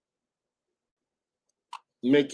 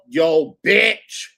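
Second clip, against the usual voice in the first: sassy mock greeting; 4 semitones higher, 7 dB louder than usual.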